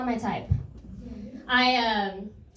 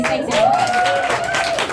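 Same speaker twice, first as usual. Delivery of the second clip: cheery sign-off; 6 dB louder than usual. Real, not acted. Sign-off shout.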